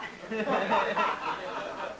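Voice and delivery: a short amused laugh at himself; real chuckle. Real, not acted. Lecturer laughing at his own bragging to the audience, chuckling. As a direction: laugh off the boast.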